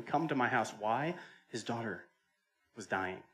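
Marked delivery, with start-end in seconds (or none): none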